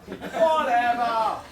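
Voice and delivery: long drawn-out, falling "whatever"; big slow shrug of a word; dismissive refrain. Acted. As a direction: stretch both halves slowly and let it drop. Repeated refrain of performance poem.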